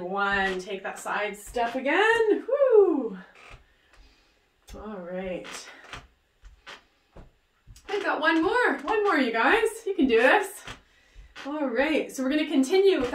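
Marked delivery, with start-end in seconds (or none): 2.5-3.2 a high, loud cheering whoop; excited whoop. Real, not acted. Fitness coach cheering her class through the transition, excited whoop. 7.9-10.5 higher and louder, pumping everyone up; rally the class for the last round; excited rallying. Real, not acted. Coach rallying viewers for one more exercise, excited.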